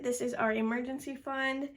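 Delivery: usual speaking voice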